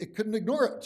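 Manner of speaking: excited outburst. Real, not acted. Amused outburst about AI failure.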